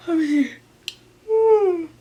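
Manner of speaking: yawning through the words; stretched out in a big yawn. Real, not acted. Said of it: "Sorry for yawning".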